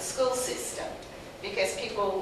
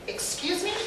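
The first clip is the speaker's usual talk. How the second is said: puzzled excuse me; 9 semitones higher, 6 dB quieter than usual. Retold or imitated. Asks again, still not understanding.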